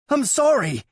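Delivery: high, loud pleading cry; desperate apology. A narrator's reading. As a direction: blurt it out in panic. Brother cries apology.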